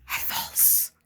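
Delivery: breathy hushed aside; whispered. Acted. Finishing the lawyer's sentence for viewers, in a whisper. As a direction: whisper the last words.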